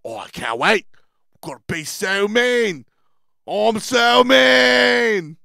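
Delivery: high, loud mock British boast, stretched to a shout. Imitating a judge before filming, wildly over-excited. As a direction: imitate an over-eager villain, building to a long shout. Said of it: "he really wanted to be mean".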